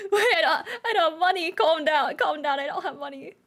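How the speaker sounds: high, giggly panic; flustered laughing protest. Real, not acted. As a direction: plead through laughter. Flustered as chat rushes to commission.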